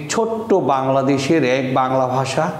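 ordinary talk, nothing marked

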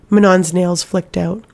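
usual speaking voice

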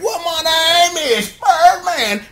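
high, drawn-out name call. Retold or imitated. Recapper imitating [name] announcing himself, hammed-up catchphrase. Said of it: "And [name], like".

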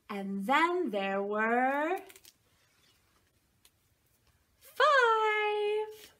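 slow, sing-song picture book reading; reading to a toddler. Acted. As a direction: read it like bedtime for a little one. Reads picture book to children.

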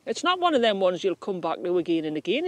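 ordinary talk

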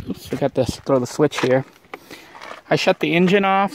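usual speaking voice

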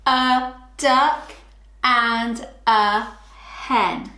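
slow, word by word with pauses; slow choral reading. Shown as a demonstration. Teacher to children, reading the sentence slowly. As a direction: say each word slowly for the class. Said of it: "Are you ready?".

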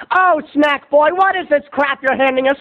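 skeptical heckler. Acted. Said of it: "audience doubting".

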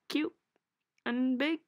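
ordinary talk

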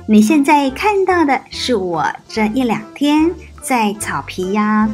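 usual speaking voice

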